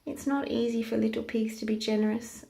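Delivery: usual speaking voice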